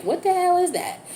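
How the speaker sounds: high-pitched puzzled viewer; mock bafflement. Viewer who can't make out the card, mock baffled.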